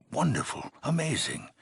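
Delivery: high, delighted exclamation; elated praise. Acted. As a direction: marvel at the achievement, pitch leaping up. Overseer praises returning hero.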